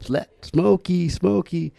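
high, drawn-out call for Smokey; calling the dog. Retold or imitated. Child calling dog Smokey each morning, high sing-song call. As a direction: call Smokey out from under the porch.

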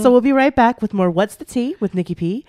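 upbeat radio sign-off, rushing the end; radio host. Acted. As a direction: close the show like a DJ.

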